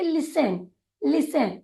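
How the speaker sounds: लिसन said "li-sen" with no t sound; silent t. Shown as a demonstration. Teacher shows a silent letter to trainees, clearly. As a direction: model लिसन cleanly, leaving the t silent. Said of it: "टी जो है वह silent है".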